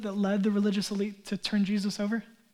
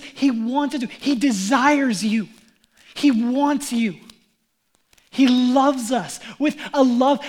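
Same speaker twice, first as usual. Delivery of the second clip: louder, slowed preaching with pressed emphasis; impassioned preaching; 3 semitones higher, 4 dB louder than usual. Real, not acted. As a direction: preach it home with fervour. Preaches the climax.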